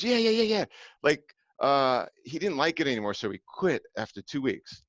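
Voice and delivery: high, breezy mom shrugging it off; imitating the mother. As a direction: brush it off like his mother. Mother Deborah voiced.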